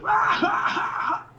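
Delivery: breaking villain laugh. Retold or imitated. Jafar losing it, a high cracking insane cackle. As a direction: let the laugh snap into madness. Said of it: "his voice breaks".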